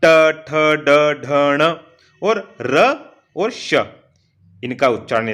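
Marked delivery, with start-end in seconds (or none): 0.1-3.7 ट ठ ड said slowly and firmly; teacher models retroflex letters slowly; retroflex drill. Shown as a demonstration. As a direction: sound out the retroflex letters deliberately. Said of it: "री बोल के देखें आप".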